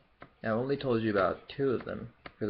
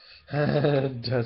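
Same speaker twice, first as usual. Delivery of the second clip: burst of laughter; 4 semitones higher, 6 dB louder than usual. Real, not acted. Laughs at own stereotype joke.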